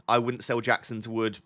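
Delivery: ordinary delivery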